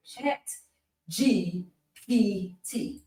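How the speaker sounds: "Chat G P T" said slowly, a pause between each piece; slow, separated letters. Shown as a demonstration. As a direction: spell out "Chat... G... P... T" carefully and softly. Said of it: "Can't say it that slow".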